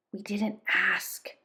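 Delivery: put-on slow, soft, hesitant boy's voice; a scared child holding back; quivering. A narrator's reading. Frightened Chip objecting to Papa John, slow and soft. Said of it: "Chip said, quivering".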